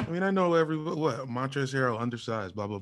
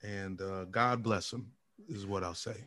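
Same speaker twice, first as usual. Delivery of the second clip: quiet; 7 semitones lower, 11 dB quieter than usual. Real, not acted. Resigned closing remark.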